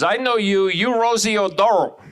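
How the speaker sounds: imitated woman in broken-English Hong Kong accent; mock Hong Kong accent. Hong Kong woman mistaking him for Rosie, mock accent. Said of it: "She goes".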